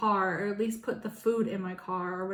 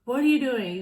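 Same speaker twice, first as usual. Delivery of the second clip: louder, sharp demand from an upset mother; retold mom's angry question; 3 semitones higher, 8 dB louder than usual. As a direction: bark it like a furious parent. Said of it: "She's like".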